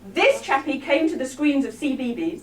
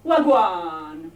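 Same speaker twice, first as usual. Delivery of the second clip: Rastamouse patois; 6 semitones lower than usual. Acted. Comic greets the audience like Rastamouse, mock patois. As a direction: put on a Rastamouse Jamaican patois. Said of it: "not quite the local patois".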